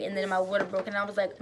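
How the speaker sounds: ordinary talk, nothing marked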